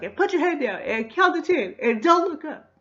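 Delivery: very high, loud mock robber's orders; shouted hold-up orders. [name] ordering the couple to count, shouted orders. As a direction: yell a string of comic stick-up commands. Fake-gun hold-up, acted out.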